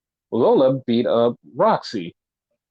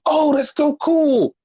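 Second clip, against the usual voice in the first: higher and slow, an overdone thrilled gush; mock delighted gush; 13 semitones higher, slower than usual. Retelling Nathan Frazier thrilled to get a second, mock delighted. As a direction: ham up how thrilled he is. Retold wrestler delighted at offer.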